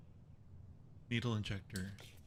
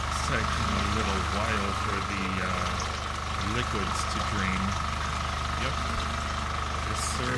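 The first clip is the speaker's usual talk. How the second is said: much louder, talking over the machine; raised voice over noise; 10 dB louder than usual. Real, not acted. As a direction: project over the machine's roar. Talking over loud draining machine.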